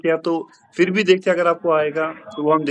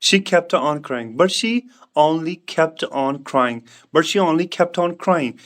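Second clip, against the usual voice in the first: slow model sentence; much slower than usual. Shown as a demonstration. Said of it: "teacher models the answer".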